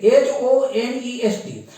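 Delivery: slow letter-by-letter spelling of एच ओ एन ई एस टी. Shown as a demonstration. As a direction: spell each letter out slowly for students. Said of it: "उच्चारण इसका लिखे हैं हम एच से".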